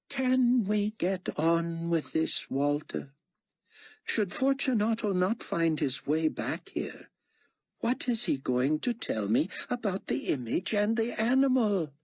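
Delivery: high-pitched imperious commander; impatient potentate. Acted. Carpathia impatient with Walter.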